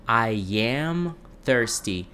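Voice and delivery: I am thirsty said very slowly, each word apart; slow model repeat. Shown as a demonstration. Teacher modeling for Brazilian students, very slow and clear. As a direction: spell out I am thirsty slowly for learners. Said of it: "a gente vai falar assim".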